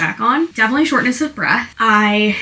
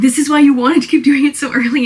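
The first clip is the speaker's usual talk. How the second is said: louder, higher mock realization; imagined exclamation; 5 semitones higher, 7 dB louder than usual. Friend working out the pregnancy secret, imitated in loud exclamation. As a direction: act out a friend's loud reaction.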